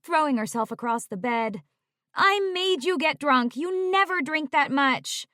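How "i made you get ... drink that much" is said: high, pleading wail; still wailing. Acted. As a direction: keep the guilty wail going. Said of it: "Tori wailed".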